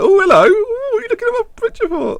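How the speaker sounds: very high, fast squeaky voice; cheeky falsetto of a smirking footballer; smirking player voice. Acted.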